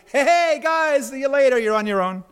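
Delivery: high, loud call in passing; breezy mock greeting. Preacher voices Jesus strolling past the disciples, breezy mock greeting. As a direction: toss off a cheery hail, then drop back.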